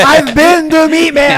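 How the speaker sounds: proud, loud declaration; loud emphatic boast. Real, not acted. Repeats the claim loudly.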